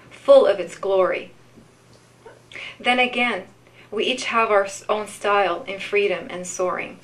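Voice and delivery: unhurried poetry reading with long pauses; poem reading. Acted. Reads a love-letter poem.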